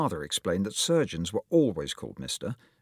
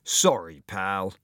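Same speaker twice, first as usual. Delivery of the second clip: sneered; 3 dB louder, slower than usual. A narrator's reading. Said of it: "Devlin sneered".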